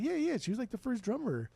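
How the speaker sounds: high, insistent customer voice; quoting a customer. Retold or imitated. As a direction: play the insistent regular.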